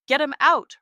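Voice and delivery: high, very loud command; shouted order. A narrator's reading. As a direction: bark the order out. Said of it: "Rohan orders".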